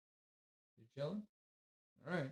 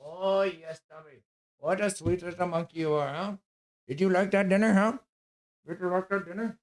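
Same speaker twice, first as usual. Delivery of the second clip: higher, sing-song pet voice, louder on the dinner question; 4 semitones higher, 7 dB louder than usual. Real, not acted. Baby talk to his dog.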